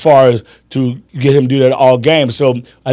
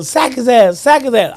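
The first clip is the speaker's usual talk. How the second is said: higher-pitched retold cheering; excited fan imitation; 7 semitones higher than usual. Friend urging on the defense in the final seconds, retold with excitement. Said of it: "Malik's jumping up and down".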